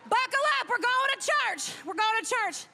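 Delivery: high, rousing cry to the congregation; mock church hype. Comedian announces going to church, high mock-preacher call. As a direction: call them out like a revved-up preacher.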